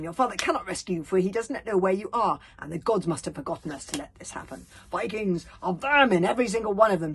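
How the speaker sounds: put-on loud, fast, furious old grandmother; spat out furiously. A narrator's reading. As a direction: spit every word with hatred. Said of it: "she spat into the darkness".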